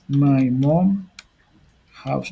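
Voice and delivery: spaced-out words, as if typing along; slow dictation. Shown as a demonstration. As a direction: say it slowly, pausing before the last word. Writing out the example word by word.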